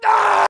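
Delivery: sudden high, loud yell; short piercing shout of frustration; frustrated scream. Real, not acted. Streamer yells at a bad guess in the game, frustrated scream.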